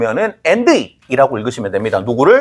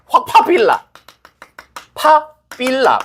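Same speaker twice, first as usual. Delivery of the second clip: higher, drawn-out mock threat; mock thug line; 8 semitones higher, slower than usual. Teacher repeating the movie threat for students, slowly. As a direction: stretch the threat out for laughs.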